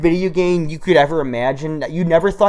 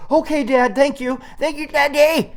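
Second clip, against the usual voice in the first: put-on much higher, sweet little-kid thanks; grateful kid voice; 12 semitones higher than usual. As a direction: thank Daddy like an overjoyed child. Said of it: "And I'm like".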